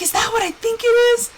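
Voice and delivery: re-enacted high, thrilled question; genuine excitement. Wife asking husband about the gift in the box, excited. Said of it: "I showed genuine excitement".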